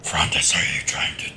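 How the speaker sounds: whisper. Pretend. Player whispers in character.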